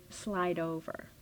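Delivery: soft, slow, low command; hushed spirit voice. Retold or imitated. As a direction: murmur it like an unseen guide. Mysterious inner voice commands.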